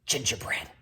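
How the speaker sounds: hushed unvoiced realization; whispered gingerbread. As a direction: whisper it in alarm.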